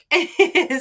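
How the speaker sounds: short, high chuckle; light laugh. Real, not acted. Chuckles mid-introduction.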